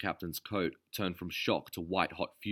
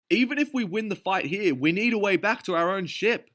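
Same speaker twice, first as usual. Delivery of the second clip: urgent warning; 13 semitones higher, 7 dB louder than usual. A narrator's reading. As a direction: lay it out tensely. Derville in battle.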